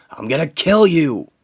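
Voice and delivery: high, loud shout; furious threat. Acted. Injured hero threatening the villain, furious. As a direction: yell it with full fury.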